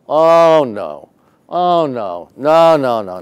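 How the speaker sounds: loud, high wails of oh no; hammed-up dread of getting caught; mock dismay. Manager realises he's being arrested, loud mock dismay.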